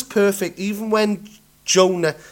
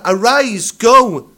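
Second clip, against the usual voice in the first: commanding call; 9 dB louder, slower than usual. Acted. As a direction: go much louder, a ringing command. Quoting God's command to Jonah.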